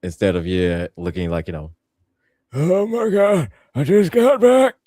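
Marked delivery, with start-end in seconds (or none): 2.5-4.7 high, loud startled cry of disbelief; shocked return. Retold or imitated. Man back from another planet gasping in shock. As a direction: shriek it like you just saw your reflection. Viltrumite returning looking old.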